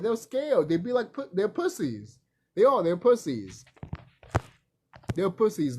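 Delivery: ordinary talk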